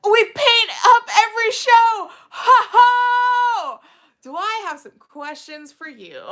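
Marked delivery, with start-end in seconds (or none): 0.1-3.8 excited shout. Pretend. Reading juggalo comment excitedly.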